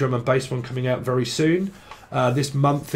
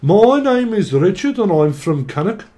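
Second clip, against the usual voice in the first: put-on Brummie accent; 7 semitones higher, 6 dB louder than usual. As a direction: introduce yourself like a proud lad from Cannock. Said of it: "something from Brummie".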